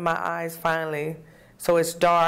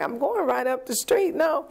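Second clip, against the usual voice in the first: nervous refusal; 8 semitones higher than usual. Retold or imitated. Refusing a stranger's ride.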